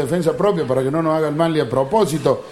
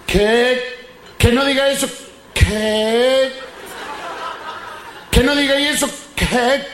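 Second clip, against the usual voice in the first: higher, halting mock stutter; stammering friend greeting; 4 semitones higher, much slower than usual. Stammering friend greeting his pal, halting and higher. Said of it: "Y dice el otro vago".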